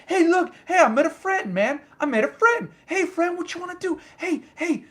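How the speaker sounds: high little bug voice; excited friendly bug. Retold or imitated. Bug follows its new friend in, high and eager. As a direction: chirp like an eager bug greeting a new pal.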